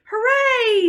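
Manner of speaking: high, loud happy shout; Froggy cheering; joyful cheer. Acted. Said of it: "sang Froggy".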